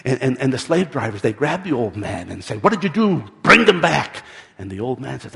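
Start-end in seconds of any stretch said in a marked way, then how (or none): 2.6-4.2 loud, high, forceful order; angry demand. A narrator's reading. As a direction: confront him and shout the command. Slave drivers grab the old man.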